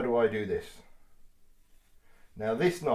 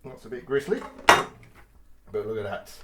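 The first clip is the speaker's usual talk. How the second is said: usual speaking voice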